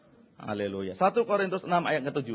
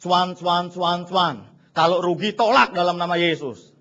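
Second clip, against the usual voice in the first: ordinary talk, nothing marked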